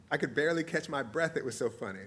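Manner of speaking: plain reading voice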